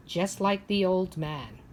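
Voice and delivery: ordinary delivery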